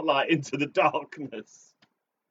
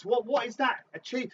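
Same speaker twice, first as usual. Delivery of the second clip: usual speaking voice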